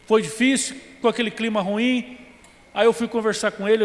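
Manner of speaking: normal talking voice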